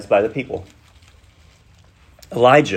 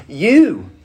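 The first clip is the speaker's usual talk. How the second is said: "you" drawn out slowly with weight; emphatic YOU; 4 semitones higher, 4 dB louder than usual. Shown as a demonstration. Preacher showing what the Greek word order means, emphatic "you". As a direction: lean long and hard on "you". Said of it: "which means it's emphatic".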